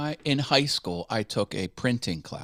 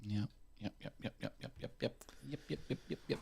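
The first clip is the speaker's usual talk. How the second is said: breathy rapid yep yep; whispered yeps; 15 dB quieter, faster than usual. Pretend. Mimicking the Newfoundland goodbye, whispered.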